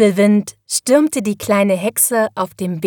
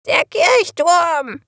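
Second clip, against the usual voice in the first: high, stretched squawk of warning; croaking raven; 9 semitones higher, slower than usual. A narrator's reading. Raven warns the witch of the church tower, croaking. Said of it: "krächzte er plötzlich".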